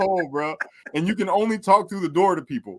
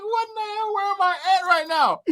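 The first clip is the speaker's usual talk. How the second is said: disbelief. Retold or imitated. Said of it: "I'm like".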